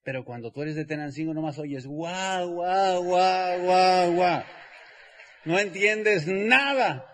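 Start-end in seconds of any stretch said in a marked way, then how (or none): 1.9-4.4 mock barking that gets louder; barking noise. What a Tenancingo guy hears from shouting gringos, barking louder and louder. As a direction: build a dog-like bark louder each time.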